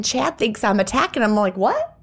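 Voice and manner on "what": high-pitched, incredulous yelp; acted-out baffled squeak; baffled reaction. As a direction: shoot the pitch way up in mock disbelief. Acts own baffled reply to husband.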